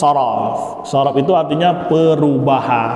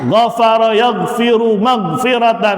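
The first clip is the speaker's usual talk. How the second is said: recitation drill; 5 semitones higher than usual. Shown as a demonstration. Teacher reciting the masdar gallery aloud, chanting. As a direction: recite each pattern clearly for the class.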